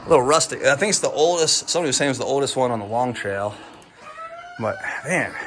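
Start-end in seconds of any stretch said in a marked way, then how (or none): none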